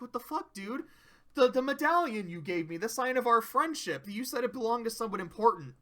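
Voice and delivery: a betrayed friend demanding answers; outraged Discord. Acted.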